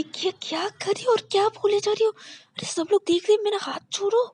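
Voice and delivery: very high, squeaky protest; flustered girly squeal. Acted. Embarrassed girlfriend squealing to be let go. Shreya flustered, hand held.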